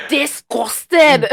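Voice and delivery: slow, high, loud drawn-out disgust; hammed-up horrified teacher; mock disgust. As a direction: drag it out like you can't bear it. Imagined teacher reaction.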